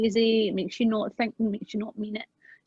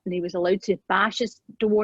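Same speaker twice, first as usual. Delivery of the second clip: ordinary talk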